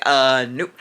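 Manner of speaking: low, loud, flat brush-off nope; dismissive passerby. Retold or imitated. Passerby brushing off the girl, low and dismissive. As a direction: wave her off with a low grunt.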